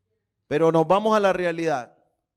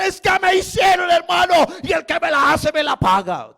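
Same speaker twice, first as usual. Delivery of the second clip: indignant grudge voice; 10 semitones higher, 5 dB louder than usual. Retold or imitated. Voicing a vengeful believer.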